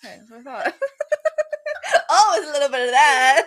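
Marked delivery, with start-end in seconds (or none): 0.7-3.4 laughing. Real, not acted. Teasing about the cropped clip.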